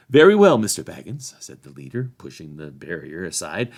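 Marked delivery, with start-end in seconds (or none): 0.1-1.3 loud, high start dropping away; officious sheriff. A narrator's reading. Sheriff leader letting Frodo pass, officious bluster.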